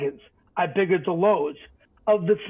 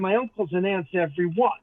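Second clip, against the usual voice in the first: usual speaking voice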